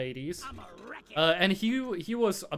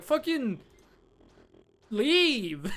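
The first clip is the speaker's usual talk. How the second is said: higher, spat out "fucking leave"; angry dismissal; 5 semitones higher, much slower than usual. Retold or imitated. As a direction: kick him out with contempt. Retelling throwing classmate out.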